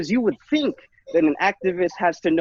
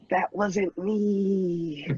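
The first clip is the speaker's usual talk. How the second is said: laughing drawl; 3 semitones higher, 3 dB quieter, much slower than usual. Acted. Deadpan aside while laughing.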